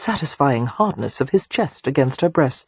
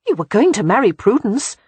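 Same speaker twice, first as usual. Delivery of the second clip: higher and louder, indignant retort; 6 semitones higher, 5 dB louder than usual. A narrator's reading. Beatrix accusing Christopher about Prudence, loud and indignant. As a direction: fire it back at him, stung and offended. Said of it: "Beatrix said indignantly".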